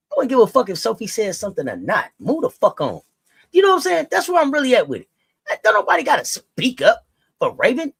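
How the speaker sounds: loud, high, heated; loud fed-up rant. Real, not acted. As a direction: vent it hard, fed up. Heated rant about the league.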